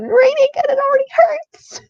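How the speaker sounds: high, hammed-up tearful cry; pained wail. Real, not acted. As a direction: wail it as if already heartbroken.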